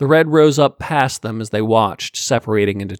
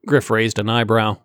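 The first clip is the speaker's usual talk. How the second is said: plain reading voice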